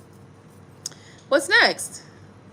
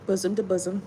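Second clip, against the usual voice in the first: mock solemn; 6 semitones lower, 8 dB quieter than usual. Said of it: "I don't know why I said that".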